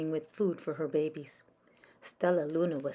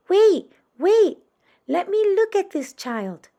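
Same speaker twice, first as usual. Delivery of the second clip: high, loud urgent voice; urgent mother bat; 14 semitones higher, 12 dB louder than usual. Acted. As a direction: push through the crowd, eager to see. Mother bat pushes through crowd.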